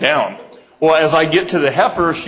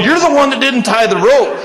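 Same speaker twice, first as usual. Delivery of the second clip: laughing blame; 9 semitones higher, 4 dB louder than usual. Retold or imitated. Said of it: "And he's going".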